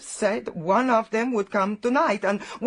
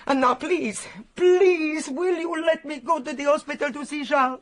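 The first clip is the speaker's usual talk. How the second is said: pleading; 7 semitones higher than usual. Acted. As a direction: beg to go to the hospital. Begs to see Charles.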